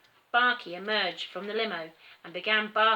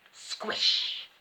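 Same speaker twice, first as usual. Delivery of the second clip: squish sound effect. A narrator's reading. Gorilla stomps on dog.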